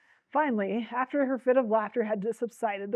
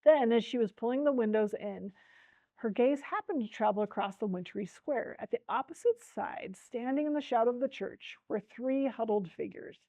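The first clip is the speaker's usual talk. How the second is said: plain reading voice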